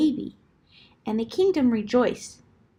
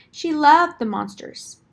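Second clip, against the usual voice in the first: loved stretched higher and louder; stress on loved; 6 semitones higher, 6 dB louder than usual. A narrator's reading. Fond emphasis in story.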